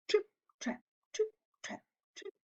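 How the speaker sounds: high, fading to a whisper; fading trip trap. A narrator's reading. Teacher fading the hoofsteps as goat leaves. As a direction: let the steps trail away. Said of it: "hooves fade away".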